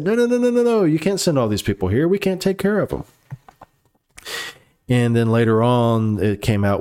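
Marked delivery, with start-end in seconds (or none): 0.0-3.0 high-pitched mock panic, voice jumping way up; panicked protest. Florida Democrats freaking out over migrants, squealing in mock panic. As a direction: imitate them freaking out, squealing no.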